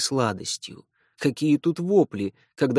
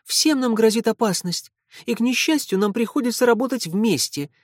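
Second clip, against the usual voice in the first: put-on agitated girl; 8 semitones higher than usual. Fi voiced, agitated.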